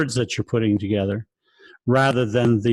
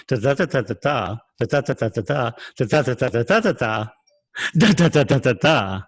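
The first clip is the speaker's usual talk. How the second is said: bouncy da da limerick beat; lilting da-da chant; limerick rhythm. Shown as a demonstration. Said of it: "the limerick gets its humor from".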